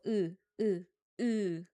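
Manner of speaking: 으 repeated alone as a model vowel; proper 으 vowel. Shown as a demonstration. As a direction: sound out 으 distinctly for learners to practise. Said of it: "this 으 vowel sound".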